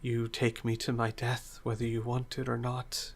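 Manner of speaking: soft, frail voice fading to whispers; halting, defeated near-whisper; weak resignation. Acted. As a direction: speak faintly, accepting your fate. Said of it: "nods slowly and then says".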